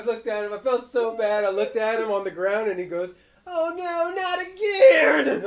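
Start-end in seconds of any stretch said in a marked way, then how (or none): 3.5-5.4 put-on high, slow wail of a hurt kid; mad wailing child. Parent retelling son's fall, high drawn-out wail. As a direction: cry it out like a boy who fell again. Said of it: "Like, mad".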